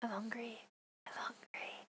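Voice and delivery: very soft, whispered cat's plea; hushed pretend begging. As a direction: whisper it as a secret translation. Whispered translation of the meows.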